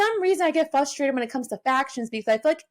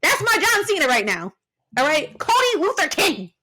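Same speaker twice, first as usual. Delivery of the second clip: heated rant; 7 semitones higher than usual. Real, not acted. Fan ranting to cohost about Cody, heated and high.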